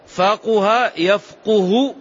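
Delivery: slow "faquha yafquhu" for فقه يفقه, u after each qaf; damma on qaf. Shown as a demonstration. Teacher demonstrating the second verb, فقه يفقه said slowly as "faquha yafquhu". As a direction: draw out فقه يفقه with "qu" in both. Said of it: "بالضم".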